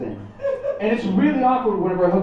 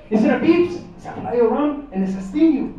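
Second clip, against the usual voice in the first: put-on Mexican dad accent; 5 semitones higher, 4 dB louder than usual. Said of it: "speaks with a wicked accent".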